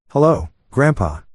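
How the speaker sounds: put-on trembling, scared teenage voice; a frightened, quivering hello; shaky voice. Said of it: "Ethan's shaky voice".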